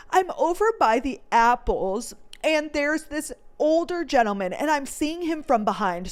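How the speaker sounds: usual speaking voice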